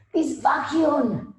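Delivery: slow, high, put-on German accent on "This vacuum". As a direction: stretch out "This vacuum" like a German salesman. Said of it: "this vacuum was a German accent".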